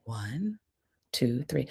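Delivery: soft, slow count; soothing slow count aloud; calm counting. Shown as a demonstration. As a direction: count gently to settle him. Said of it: "So then I might say".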